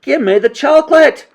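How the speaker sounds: high, loud demanding yell; shouting boy. A narrator's reading. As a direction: shout it like an indignant kid. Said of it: "shouted Bruno".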